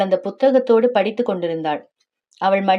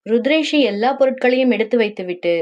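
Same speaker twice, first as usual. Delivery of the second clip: usual speaking voice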